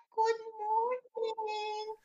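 mock mumbling teacher. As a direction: mumble it like a shy teacher who won't project. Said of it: "imagínate que yo llegara como maestra y dijera". (imitando a una maestra que murmura, aguda, lenta y muy bajita)

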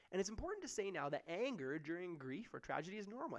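usual speaking voice

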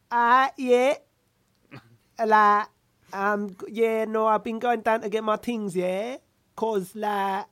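hammed-up London slang accent, loud; put-on Brixton accent. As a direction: imitate a try-hard Brixton accent. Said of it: "worked very hard on that accent".

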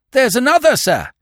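startled servant's shout; alarmed cry. A narrator's reading. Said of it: "cried Kennedy".

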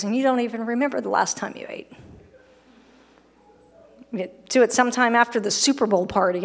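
ordinary talk, nothing marked

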